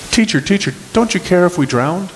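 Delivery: high, anxious call to the teacher; mild alarm, calling out to the teacher; panicked plea. Acted. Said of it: "The disciples say".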